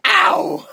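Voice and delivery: high, loud "ow"; hammed-up howl after a punch; mock yelp of pain.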